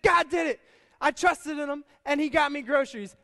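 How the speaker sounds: high, loud, unshakable praise; insistent joy. Retold or imitated. Lady answering the neighbor, loud and joyful. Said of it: "she said".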